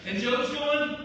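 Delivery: loud, high, fervent sermon delivery; full-force preaching. Acted. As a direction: preach it out to the whole room.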